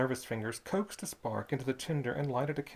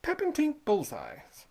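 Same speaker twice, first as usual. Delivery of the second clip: put-on high, slow, delighted boy; 13 semitones higher, 4 dB louder, slower than usual. Boy naming the candy he loves, delighted and high. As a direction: savour the candy's name like a thrilled child. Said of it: "said the boy delightedly".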